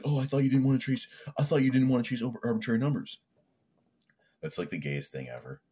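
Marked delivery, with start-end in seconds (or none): 0.0-3.2 high, loud, whiny mock accusation; mocking gotcha. Imitating people who do gotcha things, mocking high-pitched accusation. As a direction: mimic a petty nitpicker pouncing on a contradiction. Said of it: "they do gotcha things".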